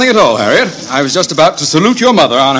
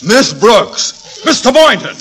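high, loud flustered alarm; shocked surprise; 14 semitones higher, 5 dB louder than usual. Acted. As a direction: caught off guard by guests. Osgood shocked by visitors.

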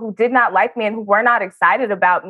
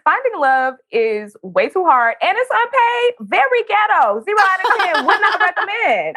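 sassy tweet; 8 semitones higher, slower than usual. Retold or imitated. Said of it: "I was like".

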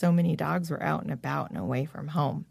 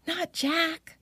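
put-on high, distressed girl's cry; a young girl's anguished cry; high girlish wail of dismay; cried out; 11 semitones higher, much slower than usual. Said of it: "Lisa Ann cried out".

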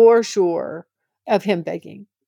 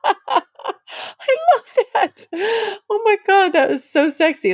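laughing delight; 13 semitones higher than usual. Real, not acted. Recalling the hot moment to listeners, laughing high. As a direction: gush while laughing.

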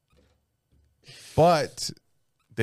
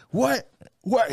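high, disbelieving double what; indignant what; 12 semitones higher than usual. Retold or imitated. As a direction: play the drunk buddy, outraged. Drunk friend protesting, retold.

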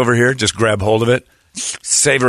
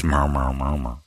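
soft cat-like meows; quiet meowing; 10 semitones lower, 6 dB quieter than usual. Retold or imitated. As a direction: meow it softly. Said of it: "and says".

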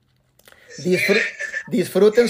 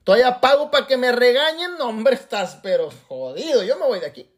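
higher, put-on voice of an offended member; indignant quitter; 6 semitones higher than usual. Offended member complaining about paying to be scolded, indignant. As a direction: huff it out like someone fed up and walking off.

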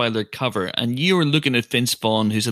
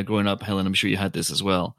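ordinary talk

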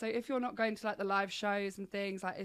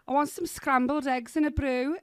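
Liverpool accent catchphrase, louder; Scouse phrase; 5 semitones higher, 6 dB louder than usual. Shown as a demonstration. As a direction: lean into a Scouse twang on the phrase. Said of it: "For some reason, mine's like".

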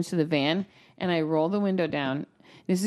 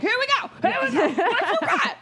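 high mock fight challenge. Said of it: "I would have been like".

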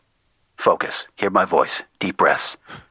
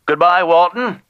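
much higher, louder sing-song goodbye; high loud farewell; 11 semitones higher, 4 dB louder than usual. Acted. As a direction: chirp it as you hang up. Informant abruptly leaves.

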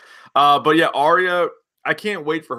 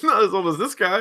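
laughing mock; 8 semitones higher than usual.

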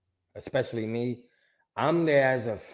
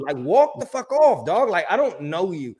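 high, louder indignant telling-off; retold fury; 6 semitones higher, 5 dB louder than usual. Telling off a stranger in the photo, retold fury. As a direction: send the intruder packing, mock-furious. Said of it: "I'm so furious".

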